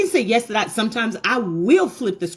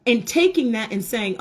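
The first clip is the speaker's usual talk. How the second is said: ordinary talk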